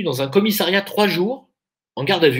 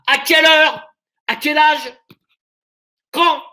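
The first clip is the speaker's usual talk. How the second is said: building rant, higher, ending in shouted questions; a preacher ranting at the crowd; mock rant; 5 semitones higher than usual. (discours exalté qui monte jusqu'au cri)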